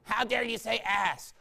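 put-on scandalized voice; mock offended objector. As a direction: mimic a prim complaint.

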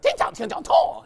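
high-pitched angry nonsense syllables; hammed-up angry mock-Mandarin outburst; mad-sounding mock Mandarin. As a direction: imitate a foreign tongue that sounds mad. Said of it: "It just sounds like they're mad at me".

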